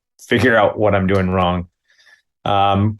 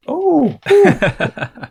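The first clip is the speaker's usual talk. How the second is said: wincing ooh. Retold or imitated. Wincing at friend's self-injury.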